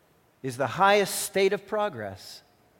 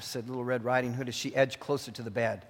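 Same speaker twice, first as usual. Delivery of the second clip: normal talking voice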